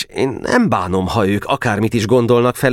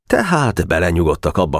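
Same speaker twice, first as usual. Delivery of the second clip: plain reading voice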